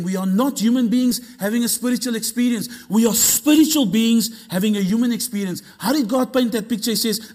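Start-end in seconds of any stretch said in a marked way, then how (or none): 0.0-2.7 higher, driving preacher's delivery; fired-up, insistent preaching; emphatic repeat. Shown as a demonstration. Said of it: "Let me say that again". 2.9-5.6 raised, louder preacher's proclamation; fired-up, insistent declaration; emphatic repeat. Shown as a demonstration. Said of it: "Let me say that again".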